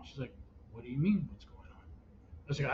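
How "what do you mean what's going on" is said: low, very soft voice; hushed imitation of a puzzled wife; retold puzzled reply. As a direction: drop right down for the other person on the phone. Wife's puzzled reply on phone.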